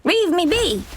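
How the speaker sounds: very high, desperate cry. Acted. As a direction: shriek it, pushing them off. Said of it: "she cries".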